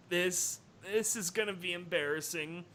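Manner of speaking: soft, quick embarrassed mutter. Acted. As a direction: mutter it under your breath. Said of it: "she muttered".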